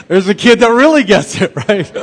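loud, high, laughing remark. Real, not acted. Preacher jokes to congregation about his son, laughing loudly.

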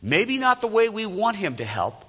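full-voiced sermon build; rising preaching fervor. Real, not acted. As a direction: drive home the promise to the congregation. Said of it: "preaching climax".

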